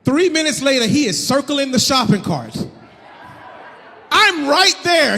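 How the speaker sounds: ordinary delivery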